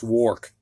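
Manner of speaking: "work" said with the vowel of "or", as WORK rhyming with "fork"; or sound. Shown as a demonstration. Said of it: "I have never heard it pronounced".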